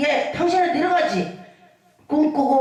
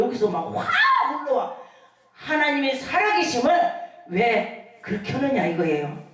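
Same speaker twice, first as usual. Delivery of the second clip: usual speaking voice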